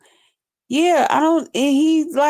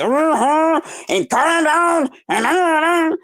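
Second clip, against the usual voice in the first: higher, whiny mock of her talking over everyone; 5 semitones higher, slower than usual. Mimicking Snoop talking over the group, whiny mock. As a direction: lightly imitate her butting in. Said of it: "and she just".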